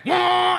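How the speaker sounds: high, loud revving wail; comic full-throttle engine noise; imitated engine wail. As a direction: scream like an engine floored. Imitating engine as toe presses gas.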